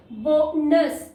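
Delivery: Bonus stretched very slowly; slow demo. Shown as a demonstration. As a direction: draw bonus out syllable by syllable. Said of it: "syllable division होगा".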